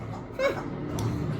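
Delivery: an airy, high giggle; laugh. Real, not acted. Laughing at Uncle Jim line.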